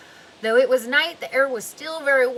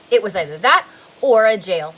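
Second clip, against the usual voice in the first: ordinary delivery, nothing marked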